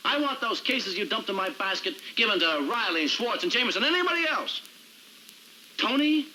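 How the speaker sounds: worked-up demand, high-pitched by the end; heated demands. Acted. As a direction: pile up demands, pitch climbing. Heated list of demands to boss.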